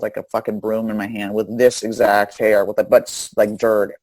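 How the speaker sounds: ordinary talk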